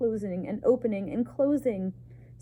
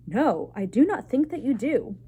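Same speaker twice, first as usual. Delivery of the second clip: stern rebuke; 4 semitones higher, 6 dB louder than usual. A narrator's reading. Stern verdict from head mouse.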